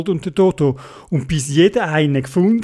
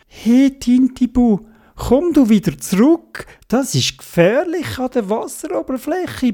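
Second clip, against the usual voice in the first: high-pitched urging voice; Theobald's warning call; 9 semitones higher than usual. A narrator's reading. Theobald warning Tintibu away from the surface, high calling voice. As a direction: raise the pitch and coax the little one back.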